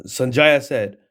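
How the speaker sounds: ordinary talk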